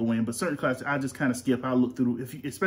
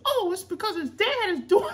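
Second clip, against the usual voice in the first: put-on very high kid voice explaining; student voice. Dad mimics a student in a high falsetto. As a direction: pitch way up like a little student. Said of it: "Enough with the voices".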